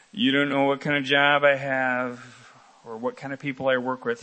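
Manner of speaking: low, heavy voice of someone making excuses; excuse-maker. Acted. Preacher voicing a congregant's excuse about work, low excuse-maker voice. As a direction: play the excuse-maker, pitched well down.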